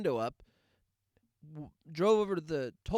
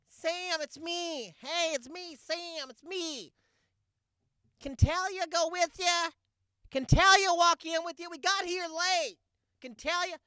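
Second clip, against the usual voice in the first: shrill mom yelling out a car window, much higher; imitating a mom yelling from her car window, much higher; 6 dB louder, slower than usual. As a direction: squeal it like an overeager mom calling from her car. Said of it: "she rolls down the window and she yells".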